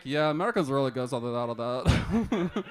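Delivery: low, quick mock accent; Portuguese host. Airbnb host shrugging off an American, low mock accent. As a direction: mumble it like an unbothered host. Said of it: "he was like".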